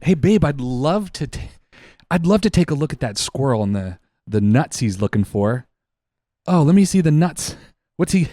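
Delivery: hammed-up husband who suddenly wants the video; eager husband. Husband pressing wife to send the squirrel video, mock loud. Husband voiced, getting worked up.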